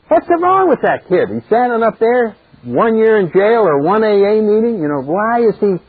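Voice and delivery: high-pitched, incredulous onlookers; exasperated townspeople. Acted. Townspeople watching the young man hesitate in court, incredulous. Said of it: "all the people are just going".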